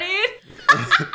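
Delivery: high laughing tag on the word. Real, not acted. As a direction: keep laughing through it. Still laughing at the crash.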